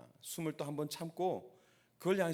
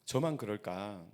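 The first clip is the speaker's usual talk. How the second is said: normal talking voice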